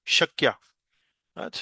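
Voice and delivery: ordinary talk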